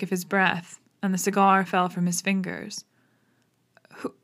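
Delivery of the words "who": halting, higher, startled stammer; a startled man stammering at the body. Acted. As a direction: trip over the words in shock. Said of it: "he stammered".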